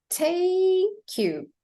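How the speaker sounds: slow "tay-kyou", "take" stretched high; linked K. Shown as a demonstration. Teacher corrects the separated version, linked K. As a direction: stretch "take" and slide its K onto "you". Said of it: "connect the words".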